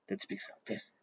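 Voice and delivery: cold, cut Prussian accent in a voiceless undertone; Prussian accent imitation. Shown as a demonstration. Showing how Prussian Germans speak, cold cut Prussian accent. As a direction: bite off the words softly, Prussian-style. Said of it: "that's Prussian".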